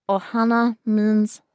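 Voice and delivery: slow character voice pushed up into the nose; all nasally. Shown as a demonstration. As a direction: push it slowly into the nasal resonators. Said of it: "So you it's all nasally".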